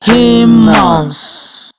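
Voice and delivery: HU-MANS split into two slow even beats; teacher modeling the syllables of Humans; clapped syllables. Shown as a demonstration. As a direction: say Humans beat by beat, one clap per syllable.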